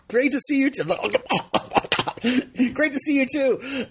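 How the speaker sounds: high, giddy fanboy gushing with a laugh. Retold or imitated. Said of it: "totally, like, fanboying out".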